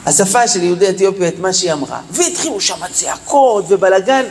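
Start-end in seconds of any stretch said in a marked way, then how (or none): none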